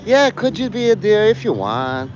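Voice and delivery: mock picky refuser; a lightly voiced fussy complainer; whiny complainer. Acted whiny refugee reply.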